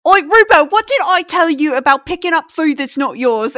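put-on high, loud scolding old cook. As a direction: scold him like a stern kitchen matron. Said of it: "she's like".

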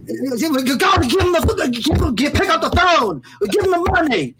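high-pitched, agitated rock-star imitation. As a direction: plead in a high, stumbling put-on voice. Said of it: "Do the whole podcast in that voice".

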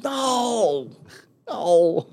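high, drawn-out mock "no"; emphatic denial. Friend answers Marine who thought him dead, hammed-up denial. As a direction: stretch out an exaggerated, high-pitched denial.

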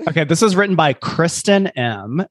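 ordinary talk

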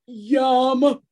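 ordinary talk

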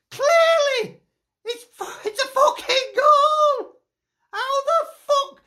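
a real shout, pitched high, slow on goal; outraged shout. Real, not acted. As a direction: yell it at the referee in disbelief.

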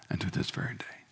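hushed and intimate; whispered assurance. Acted. Preacher whispering assurance to the congregation. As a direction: whisper unto this very day.

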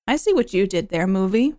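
ordinary talk, nothing marked

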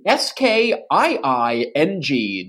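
each letter slow and separate; spelled out slowly. Shown as a demonstration. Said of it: "Note that".

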